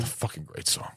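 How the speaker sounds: breathy whispered aside; under the breath; whispering. Real, not acted. Aside while others talk.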